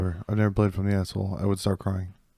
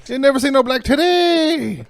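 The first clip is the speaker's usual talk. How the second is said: very high, loud, slow incredulous voice; vendor impression; 9 dB louder, slower than usual. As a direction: echo the vendor's disbelief. Echoing the vendor line.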